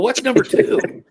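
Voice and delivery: high, loud, stretched-out question; retold exasperated cry; mock panic. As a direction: blurt it in hammed-up dread.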